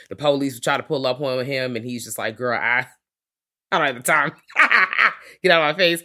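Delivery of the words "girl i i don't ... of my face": sassy, louder brush-off with a laugh; dismissive brush-off. Retold or imitated. Blacula brushing off the police, sassy and loud. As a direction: wave the cops away, no time for them.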